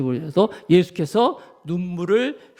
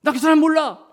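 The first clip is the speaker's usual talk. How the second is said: frightened denial; 9 semitones higher, 4 dB louder than usual. Acted. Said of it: "베드로가 겁이 나가지고".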